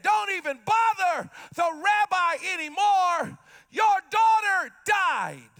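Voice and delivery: rising frantic shout; servant yelling bad news. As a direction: cry out the news as if to a desperate father. Same words now shouted.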